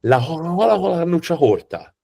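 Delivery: exaggerated Tuscan, every hard C breathed as H; high, mocking Tuscan "hoha-hola"; Tuscan accent. Shown as a demonstration. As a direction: imitate a Tuscan who can't say K. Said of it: "The Tuscans sound like this".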